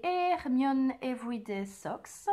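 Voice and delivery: English title in a French accent. Real, not acted. Knitter naming an English sock pattern, French accent. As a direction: pronounce it slowly with a French accent. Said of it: "pardon pour mon accent anglais".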